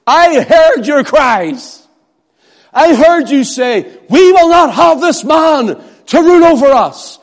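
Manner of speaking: high, loud mob shout; shouting crowd. Acted. Preacher as Peter echoing the crowd's cries, loud shouting. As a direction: roar it like the furious crowd. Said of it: "Peter says".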